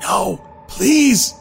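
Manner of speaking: high pleading cry; anguished cry. Acted. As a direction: cry out in desperate plea. Pleading cry during panic.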